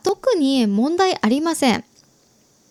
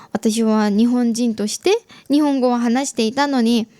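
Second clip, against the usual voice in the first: usual speaking voice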